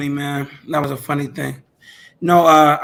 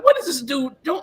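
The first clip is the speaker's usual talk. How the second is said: incredulous inner voice; 10 semitones higher than usual. Retold or imitated. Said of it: "I'm like".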